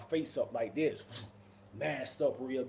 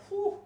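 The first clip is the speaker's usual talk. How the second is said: high, breathy relief; relieved whew. Retold or imitated. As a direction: let out a big whew.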